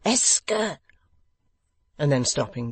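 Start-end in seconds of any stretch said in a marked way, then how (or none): 0.0-0.8 higher, louder, abrupt French phrase; awkward young man trying French; raised voice. A narrator's reading. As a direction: blurt it out then stop dead. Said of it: "in a raised voice".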